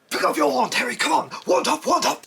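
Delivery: high, loud taunting shout; villain shouting. Acted. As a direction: bark it like a gleeful villain. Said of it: "He was all like".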